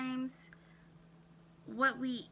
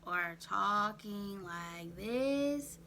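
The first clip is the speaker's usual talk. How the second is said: very slow, drawn-out "talking like this"; 4 semitones lower, much slower than usual. Shown as a demonstration. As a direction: stretch each word out slowly and heavily.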